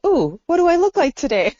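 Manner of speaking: high, bright squeal of delight; excited high peek under the sheets; playful high wonder at a new body; giddy curiosity. Retold or imitated. Imagining waking in new body.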